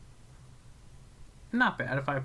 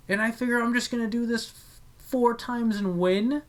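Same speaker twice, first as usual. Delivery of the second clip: mock naive voice; 8 semitones higher, 3 dB louder than usual. Mocking own imagined plan.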